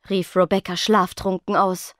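usual speaking voice

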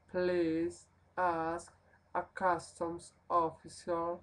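slow, deliberate English read aloud; very slowly. Shown as a demonstration. Teacher reads the customs sign very slowly for the class. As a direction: space every phrase out for learners. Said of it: "voy a hablarlo muy despacio".

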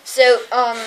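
normal talking voice

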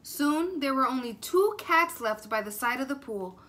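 usual speaking voice

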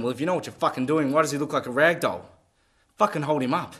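exasperated and swearing, pushing the volume; angry challenge. Acted. Angry confrontation with the nurses.

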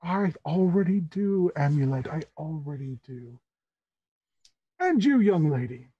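measured, theatrical fascination; intrigued Tabaxi uncle. Acted. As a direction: savor the new guest, pausing between phrases. Tabaxi host welcomes Amulet.

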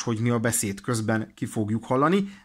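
normal talking voice

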